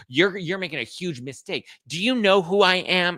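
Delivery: high, loud do-you-know-who-I-am squeal; entitled mock outrage. Entitled drunk woman.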